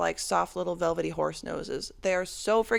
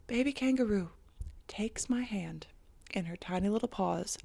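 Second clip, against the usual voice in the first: awed whisper; 8 dB quieter than usual. Real, not acted. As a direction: hush it like a precious moment. Tender moment with baby kangaroo.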